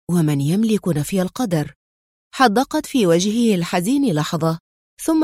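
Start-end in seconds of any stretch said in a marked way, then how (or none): none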